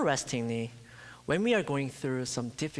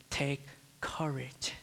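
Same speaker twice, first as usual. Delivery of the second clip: slow hushed whisper, no voice; whispered comfort; 6 dB quieter than usual. Acted. Christ speaking softly to a fearful heart, slow whisper. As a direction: whisper it slowly like a secret comfort.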